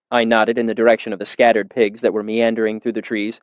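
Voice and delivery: ordinary talk, nothing marked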